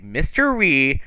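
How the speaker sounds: high, loud scolding woman's voice; scolding lady. Acted. Lady scolds the kid over curfew, loud and exasperated. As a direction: bellow it like a fed-up matron. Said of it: "thick lady at the opposite end, and she's like".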